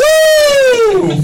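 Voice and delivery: high, loud whoop; excited whoop. Real, not acted. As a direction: let out a party cheer. Cheering the new booze.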